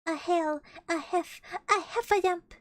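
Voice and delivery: panting, stumbling toward the word; breathless stammer. Acted. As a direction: gasp out the word in pieces. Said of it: "breathing so hard he could hardly speak".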